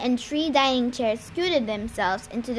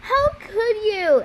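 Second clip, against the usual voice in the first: high, louder outraged cry; very angry; 8 semitones higher, 5 dB louder than usual. A narrator's reading. Annabeth confronting Luke over the tree, very angry. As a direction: confront him, voice pitched up in anger. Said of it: "Annabeth sounded so angry".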